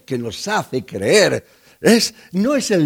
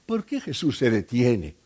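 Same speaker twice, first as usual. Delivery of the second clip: ordinary talk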